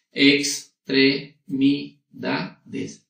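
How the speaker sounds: Extremidades stretched slowly into its five syllables; slow the word Extremidades right down. Shown as a demonstration. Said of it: "Tiene cinco sílabas".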